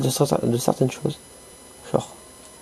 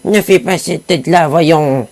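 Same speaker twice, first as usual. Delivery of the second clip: put-on old granny voice; slower than usual. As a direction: play the doting grandmother. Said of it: "je vais arrêter cette voix-là". (voix aiguë de grand-mère)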